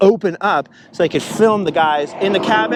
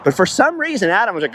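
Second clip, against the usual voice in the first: normal talking voice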